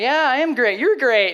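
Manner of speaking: high, loud, gushing enthusiasm. Retold or imitated. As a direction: play giddy mutual admiration at a party. Couple gushing while dancing at party.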